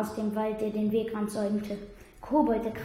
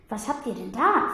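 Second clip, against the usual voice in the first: neugierig. A narrator's reading. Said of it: "fragte Finoligos neugierig".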